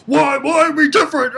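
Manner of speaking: mock outraged shout; pissed-off yell. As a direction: throw the why at her in fury. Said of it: "he's so pissed".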